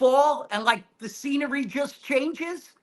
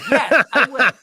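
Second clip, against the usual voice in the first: eager laughing yes; 8 dB louder than usual. Retold or imitated. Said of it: "I go".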